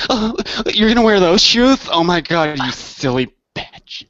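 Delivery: high mocking gossip, slowing at the end; catty girl voice. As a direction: play a catty girlfriend judging shoes. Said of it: "sit in the room and be chicks".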